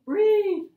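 sharp loud gasp, then a high breathless "Breathe"; gasp for air. Shown as a demonstration. Teacher acts out a breathless writer, high and loud. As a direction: gulp air like a student out of breath. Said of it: "Put a comma in there somewhere".

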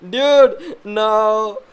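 high, loud, drawn-out disbelief; shocked. Real, not acted. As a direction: protest in startled disbelief. Shocked by John Cena jump scare.

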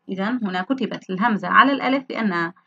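normal talking voice

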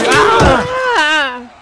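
sudden high pained cry, hammed-up; loud mock injury yell. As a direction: yelp like a player going down. Injury scream in skit.

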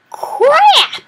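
very high, loud scream; real panicked shriek; shrill yelp. Real, not acted. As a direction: shriek on being caught. Sudden loss in game.

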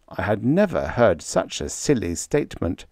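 ordinary delivery

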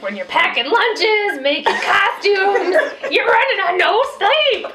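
high, loud mock tirade; hammed-up exasperated yelling; frazzled mom yelling. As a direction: rant like a mom at the end of her rope. Yelled mom rant continues.

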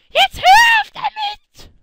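very loud, high, slow shout; angry shout. Acted. Milchbubi screams at Gomme to stop trolling, very loud and high. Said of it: "Ich muss dauernd schreien wegen Gomme".

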